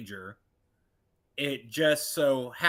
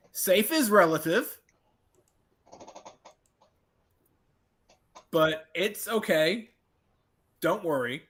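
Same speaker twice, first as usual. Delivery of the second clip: higher, louder, breezy reassurance; child reassuring parent; 5 semitones higher, 4 dB louder, slower than usual. Acted. Grown child replying to worried dad, breezily reassuring. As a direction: brush off the worry with a light laugh in the voice. Player answers parent in character.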